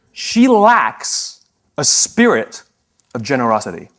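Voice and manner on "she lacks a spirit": drawn out, high and loud; slow punchline. Real, not acted. Speaker delivers the joke about the seatmate, slow and emphatic. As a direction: land the punchline slowly. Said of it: "here's the joke".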